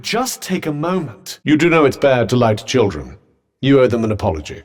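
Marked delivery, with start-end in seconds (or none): 1.4-4.6 low, loud reprimand; stern scolding. Acted. Companion scolding Frey for lying to children, stern. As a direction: scold firmly from a lower pitch.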